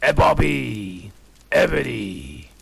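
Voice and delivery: a hint of a dark lord calling; evil villain. A narrator's reading. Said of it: "said evilly in his raspy voice".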